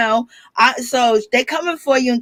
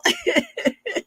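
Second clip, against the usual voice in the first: high, soft laughing fit; amused laughter; 5 dB quieter than usual. Real, not acted. Vlogger cracks up at the violin joke, amused laughter. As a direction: laugh helplessly at your own joke.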